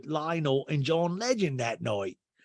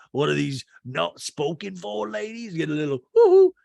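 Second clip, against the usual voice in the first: hammed-up chummy man, whooping on "hoo-hoo"; 2 semitones higher, 4 dB louder than usual. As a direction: mimic a man slyly egging on his mate. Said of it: "I love that he's like".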